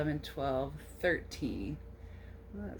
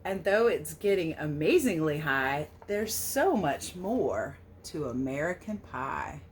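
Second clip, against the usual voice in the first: storybook read-aloud; 4 dB louder than usual. A narrator's reading. Reading the American Pie book aloud to children, storybook lilt. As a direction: read the verse a little louder, with a gentle lilt. Reads the picture book text.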